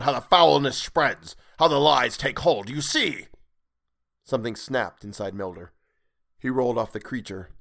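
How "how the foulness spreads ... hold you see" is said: raised, high ranting voice; zealot's loud rant; angry yell. A narrator's reading. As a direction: rail at them in red rage. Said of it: "he yelled".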